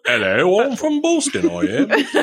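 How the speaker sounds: hammed-up, high-pitched Boston accent; bad Boston accent. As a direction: badly fake a Boston accent for laughs. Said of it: "what a Boston accent sounds like".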